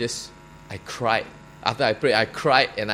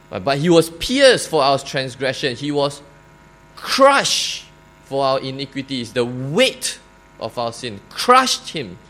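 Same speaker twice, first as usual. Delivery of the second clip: preaching; 6 dB louder than usual. Acted. Preaching the crucifixion.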